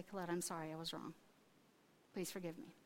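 low, soft, contrite admission; humble apology. Retold or imitated. Retold humble apology to daughter.

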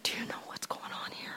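whispered aside to her; a secret whisper in a noisy room. Retold or imitated. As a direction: lean in and whisper it. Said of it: "I leaned over and I said".

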